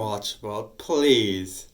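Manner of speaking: low and loud possessed voice; deep coarse man's voice. Acted. Parasite mocks sister that her brother is gone, low and coarse. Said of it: "a deep, coarse man's".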